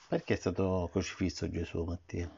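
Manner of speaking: normal talking voice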